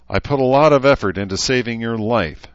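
resentful, emphatic complaint; bitter. A narrator's reading. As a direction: throw it at him bitterly. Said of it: "she said bitterly".

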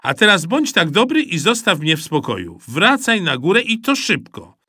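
loud, sharp order; mother ordering son upstairs; impatient mother. A narrator's reading. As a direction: send him away now.

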